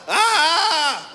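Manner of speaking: loud, high drawn-out holler; big "aaah" battle cry; cathartic yell. Acted. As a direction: let it all out in one yell. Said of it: "a guy's gotta yell".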